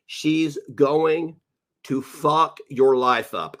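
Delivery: slow, stress on fuck; slow warning. Real, not acted. Streamer warning Shane slowly and gravely. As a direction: drag out the warning. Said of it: "slow emphatic warning".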